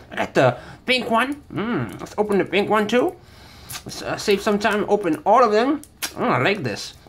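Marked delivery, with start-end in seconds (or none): none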